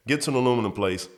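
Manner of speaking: usual speaking voice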